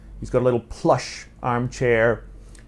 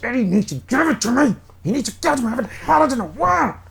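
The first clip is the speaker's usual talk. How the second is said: very high, strained, desperate pleading; frantic begging; 11 semitones higher, faster than usual. Acted. Agitated Max demanding his fix from Ioane, high frantic whine.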